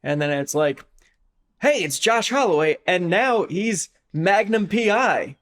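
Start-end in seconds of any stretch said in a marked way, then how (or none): 1.6-5.4 higher, drawn-out show announcement; mock promo announcer. Host mockingly presents an actor as Magnum P I, hyped announcing. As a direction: announce the star's new role with fanfare.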